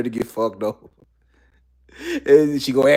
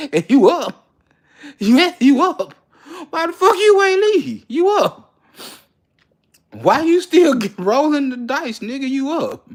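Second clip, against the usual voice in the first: much higher, louder exasperated shout; exasperated yelling; 12 semitones higher, 4 dB louder than usual. Acted. Scolding the gambler who won't leave, high exasperated yell. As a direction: yell at him to walk away.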